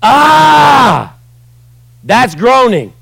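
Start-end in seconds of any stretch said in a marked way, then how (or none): 0.0-1.2 loud aching cry of anguish; groan. Shown as a demonstration. Preacher showing ugly crying to the church, loud anguished groan. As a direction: let out a raw, ugly groan.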